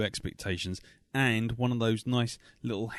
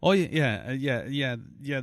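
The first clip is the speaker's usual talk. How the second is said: ordinary talk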